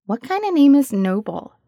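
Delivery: a hint of a blunt curious kid; young girl voice. A narrator's reading.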